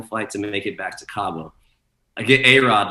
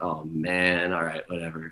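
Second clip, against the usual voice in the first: resigned; 4 semitones lower, 7 dB quieter than usual. Retold or imitated. Resigned reaction to retold grievance.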